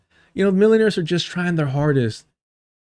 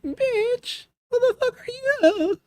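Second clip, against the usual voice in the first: very high, softer falsetto mimicry; mocking his voice; 4 dB quieter, slower than usual. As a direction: squeak out a mock of his outrage. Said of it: "You can hear it in his voice too".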